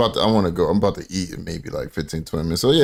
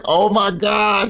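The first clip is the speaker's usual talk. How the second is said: high, drawn-out scandalized exclamation; imitating amused shock; 13 semitones higher, much slower than usual. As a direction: play mom cracking up in disbelief, slowly. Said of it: "she starts laughing".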